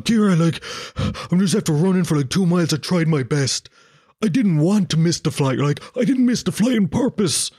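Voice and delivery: a touch of teary pleading; mock-emotional whine to his girlfriend; whiny emotional plea. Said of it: "I started being like a twat then. You know when you're like very emotional?".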